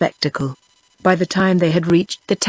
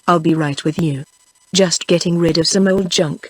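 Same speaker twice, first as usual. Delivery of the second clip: ordinary delivery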